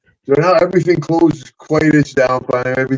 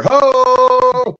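high loud whoop; 12 semitones higher, 5 dB louder than usual. Retold or imitated. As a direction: let out a big triumphant ho. Whoops heading out.

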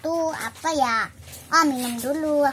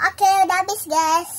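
excited; 7 semitones higher, 6 dB louder than usual. Real, not acted. Cheerful announcement to viewers.

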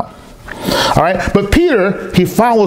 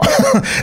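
short high burst of laughter; quick high chuckle. Real, not acted. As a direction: laugh at your own outburst. Laughs after own outburst.

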